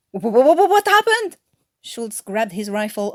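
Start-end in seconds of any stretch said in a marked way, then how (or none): 0.1-1.4 stammering, loud and high with fright; startled stammer. A narrator's reading. Guard startled by a scream, stammering in fright. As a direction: jump out of your skin and stutter. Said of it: "making Schultz jump a foot".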